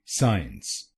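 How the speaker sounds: साइंस said as one syllable, "saans"; साइंस run together, no y glide; wrong pronunciation. Shown as a demonstration. Teacher showing students the common mistake, saying साइंस as one syllable. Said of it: "इस शब्द को कई बार लोग गलत बोलते हैं".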